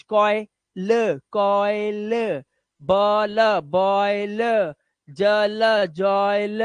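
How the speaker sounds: कॉयल, बॉयल, जॉयल with held 'oy' and separate final 'l'; slow sounding-out. Shown as a demonstration. Teacher building words for slow learners, slow sounding-out. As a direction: stretch each word, कॉयल as 'koy-luh'. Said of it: "इस तरह से आप धीरे धीरे".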